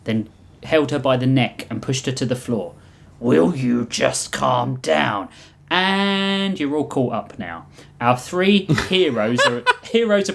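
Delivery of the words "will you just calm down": Oryx voice. Acted. Oryx restrains the Reap.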